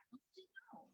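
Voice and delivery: breathy whisper, hushed. Acted. Reader whispering to the children, hushed whisper. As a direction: whisper it like a secret. Whispered aside during story.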